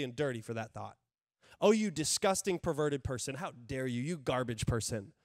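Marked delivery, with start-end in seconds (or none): 1.6-5.1 a hint of a sneering accuser, dropping low; mock the devil's contempt, sinking deep. Pastor voicing the enemy shaming a believer, lightly sneering. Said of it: "the enemy, he wants you to feel filthy".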